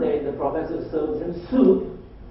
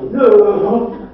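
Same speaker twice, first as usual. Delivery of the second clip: loud, laughing mock-incredulous no; mock disbelief; 5 semitones higher, 13 dB louder than usual. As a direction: blurt it out higher, as amused disbelief. Acting out people's laughing disbelief.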